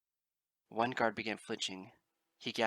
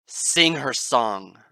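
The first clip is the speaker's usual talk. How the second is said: very loud, slow, drawn out; booming command; 2 semitones higher, 17 dB louder, much slower than usual. Acted. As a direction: boom the order across the room. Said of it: "Celestia shouted".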